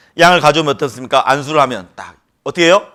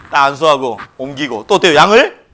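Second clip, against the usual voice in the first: normal talking voice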